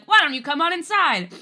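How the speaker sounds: high, loud street-side hawker; restaurant tout. Retold or imitated. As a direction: call out to passersby like a restaurant greeter. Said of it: "outside of the restaurants being like".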